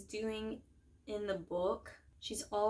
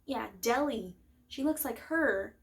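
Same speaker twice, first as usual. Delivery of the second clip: higher, louder quick-cover act; raised voice, playing along to cover for someone; Peeta; 6 semitones higher, 4 dB louder than usual. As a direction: imitate someone chiming in, pitched up. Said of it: "And then Peeta's like".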